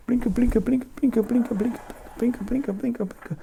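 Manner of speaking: high sing-song blinka chant; high chanted fire noise; high flicker chant. Acted. Imitates magic fire flicker.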